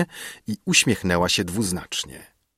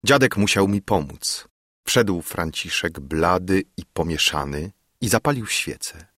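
plain reading voice

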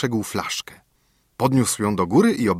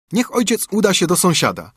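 high-pitched, louder voice of a willing son; eager son; 9 semitones higher, 3 dB louder than usual. A narrator's reading. Son offering to help father at work, eager high voice.

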